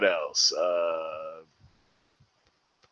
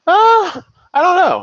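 excited; 7 dB louder than usual. Real, not acted. Host reacts on podcast.

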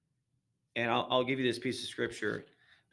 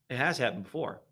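normal talking voice

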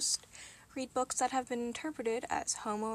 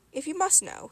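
a touch of irritation, pitched higher; a trifle testy; 6 semitones higher, 5 dB louder than usual. A narrator's reading. Angel confessing to the demon, a trifle testy. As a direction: mildly put out, defensive. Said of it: "he said a trifle testily".

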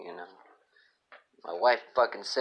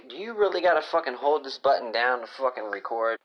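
ordinary delivery

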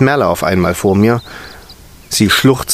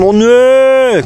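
entsetzt; 11 semitones higher, 5 dB louder than usual. Acted. Erzähler stöhnt über Kinder.

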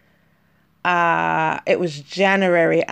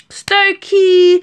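a punchy, higher, louder "Stokey." in a London accent; 13 semitones higher, 8 dB louder than usual. Shown as a demonstration. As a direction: throw out "Stokey." like a proper Londoner. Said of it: "I sound like I'm from".

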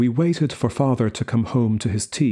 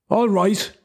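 loud high shout; 10 semitones higher, 3 dB louder than usual. A narrator's reading. Father shouts at mother, furious. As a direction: bellow it in temper. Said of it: "he shouted".

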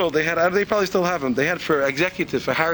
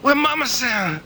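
Chinese waiter voice; 12 semitones higher, slower than usual. Acted. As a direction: cry out for Mama Sam in broken English. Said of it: "the Chinese guy says".

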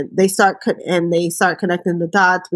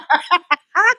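laughing; 9 semitones higher than usual. Real, not acted. Laughing at the scene.

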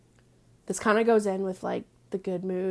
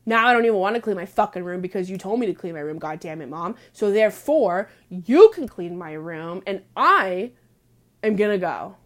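annoyed rant; 8 dB louder than usual. Retold or imitated. Ranting back at mom.

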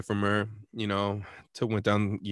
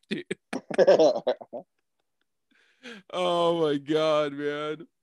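ordinary talk, nothing marked

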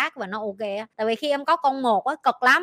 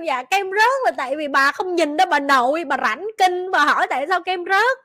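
higher, mock-polite retort; sarcastic mother; 5 semitones higher, 4 dB louder than usual. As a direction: tease the kid dryly. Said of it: "Kêu".

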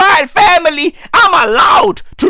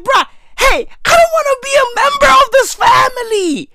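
high-pitched mock-outraged refusal; indignant protest; 11 semitones higher than usual. Retelling his revolt against crying relatives, mock indignant. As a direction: renounce them in a high, strained protest.

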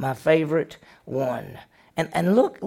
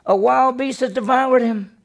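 high, anguished cry of loss; a father seeing his son's bloody coat; grieving father; 8 semitones higher, 3 dB louder than usual. Retold or imitated. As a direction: Jacob crying out over Joseph's tunic. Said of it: "He said".

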